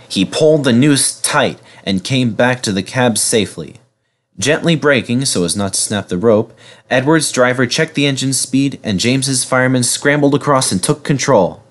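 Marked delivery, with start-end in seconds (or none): none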